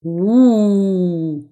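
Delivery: low, drawn-out imitated howl; approving wolf howl. A narrator's reading. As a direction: howl a wolf's yes. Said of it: "fait le loup en signe d'approbation". (hurlement de loup approbateur)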